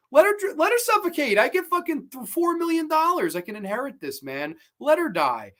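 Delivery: high-pitched, worked-up retold stepfather; callous mock outburst. Stepfather gives up on Candy for the money, callous. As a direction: push the pitch right up, mock-heartless.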